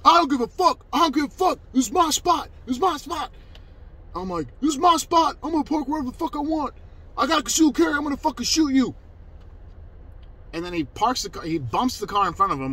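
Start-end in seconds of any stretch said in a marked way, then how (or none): 0.0-3.3 high, yelled caricature of a belligerent man; pitched-up, in-your-face impersonation; loud, imitated aggressor. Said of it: "Just loud as fuck". 4.6-9.0 high, fast, shouted threats in imitation; mock his belligerent territorial yelling; loud, imitated aggressor. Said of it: "this is me imitating him".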